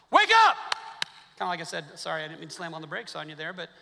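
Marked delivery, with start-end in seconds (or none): 0.1-0.6 a full-force shout, much higher and louder; preacher jolts a drowsy congregation with a startling shout; sudden shout. Real, not acted. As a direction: slam the brakes on the congregation with a yell. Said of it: "I don't yell very much, so hopefully when I yell it".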